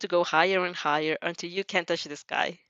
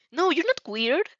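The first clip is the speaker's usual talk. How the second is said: lightly voiced, higher reassurance; a gentle acted protest, pitched up; reassuring; 9 semitones higher than usual. A narrator's reading. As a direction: raise the pitch to reassure someone. Acted reassurance in read dialogue.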